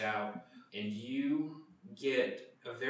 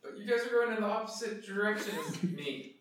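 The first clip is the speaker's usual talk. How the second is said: high peddler's voice breaking into a laugh; high, amused complaint; protesting merchant; 10 semitones higher than usual. Acted. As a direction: object helplessly to departing customers, half laughing. Merchant figure protests to passing customers.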